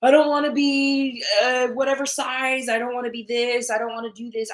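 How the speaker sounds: mock negative self-talk; negative affirmation. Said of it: "in a negative tone".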